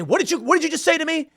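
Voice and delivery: high-pitched mock outrage; imitating an offended stranger; mock confrontation. Retold angry confrontation before a beating.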